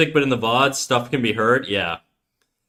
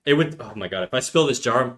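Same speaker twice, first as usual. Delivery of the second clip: usual speaking voice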